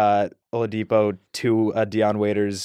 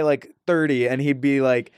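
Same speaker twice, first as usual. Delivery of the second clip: ordinary talk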